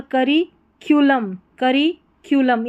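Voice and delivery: करिक्यूलम slowly, broken into "kari" and "kyoolam"; slow model pronunciation. Shown as a demonstration. Teacher reading out the given pronunciation, करिक्यूलम slow and in parts. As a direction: draw out करिक्यूलम in two parts with a "kyoo" glide. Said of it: "यहाँ पर उच्चारण दिया गया है".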